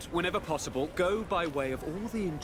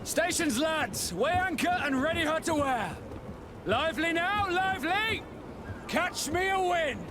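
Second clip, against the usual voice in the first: shouted orders; 11 semitones higher than usual. Acted. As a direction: bark commands across the deck. Captain shouting orders on deck.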